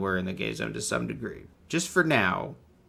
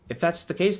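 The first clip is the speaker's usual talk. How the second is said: ordinary delivery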